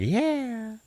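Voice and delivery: high squeaky little voice; tiny cartoon food voice; squeaky character voice. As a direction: pipe it up like a cartoon snack. Food voiced in retold movie.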